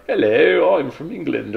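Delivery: a deliberately terrible posh-English put-on; bad English accent. Recalling textbook recordings, a hammed-up English accent. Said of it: "I did really bad accents".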